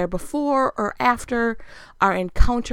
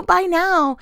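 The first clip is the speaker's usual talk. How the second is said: ordinary delivery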